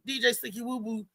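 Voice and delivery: ordinary talk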